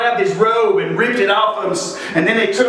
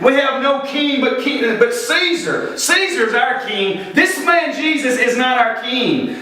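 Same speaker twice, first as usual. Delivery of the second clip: high-pitched outcry at usual volume, drawn out; mock crowd screaming; 6 semitones higher than usual. Crowd screaming back at Pilate that they have no king, imitated high outcry. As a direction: imitate an angry mob crying out, voice pitched up. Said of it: "they started screaming".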